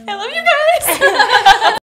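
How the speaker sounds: very high, loud, drawn-out squeal through laughter; gushing affection. Real, not acted. Friend overwhelmed by the group's compliments, gushing loudly.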